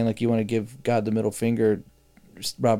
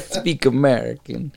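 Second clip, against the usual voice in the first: put-on slow Italian accent on "Speak American"; slower than usual. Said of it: "I said that with, like, an Italian accent".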